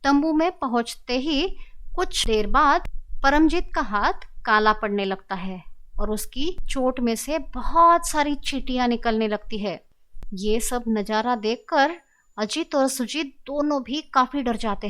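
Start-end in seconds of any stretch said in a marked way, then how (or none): none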